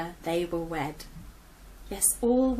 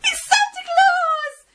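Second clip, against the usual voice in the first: very high, loud squeal of joy; cheering; 11 dB louder than usual. Acted. Piglet cheering Gran's chimney arrival, excited squeal. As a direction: squeal it out with delight. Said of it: "one piglet cheered".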